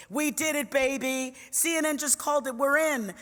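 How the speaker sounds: high, loud jubilant phone call; excited friend. Retold or imitated. Friend calling with election news, excited and elated. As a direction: crow the win down the phone.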